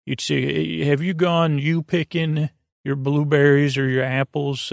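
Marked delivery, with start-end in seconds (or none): none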